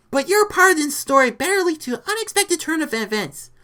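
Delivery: high, loud demand; exasperated warning. A narrator's reading. Rita warning lizard about his story part, exasperated.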